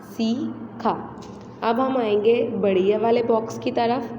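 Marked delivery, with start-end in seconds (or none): 0.0-1.2 सीखा drawn out slowly, the thin ई held long; stretched demo word. Shown as a demonstration. Teacher to pupils, slow sounded-out सीखा. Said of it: "छोटी ए की जो आवाज़ होती है वो बारीक".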